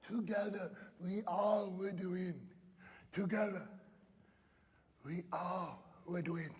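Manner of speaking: slow, soft and moved; tender. Retold or imitated. Girl telling her coach they all win, slow and tender. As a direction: say it softly, full of feeling. Girl's moved words at finish.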